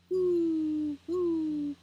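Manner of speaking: high, loud hooting owl call. Acted. Reading Good Night Owl to children, high loud owl hoot. Owl hoots in bedtime book.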